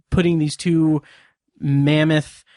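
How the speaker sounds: ordinary talk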